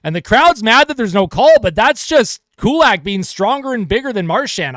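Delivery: thrilled commentator calling a scoring chance; excited play-by-play. Acted. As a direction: build it up as the chance on net develops. Rush on net, crowd upset.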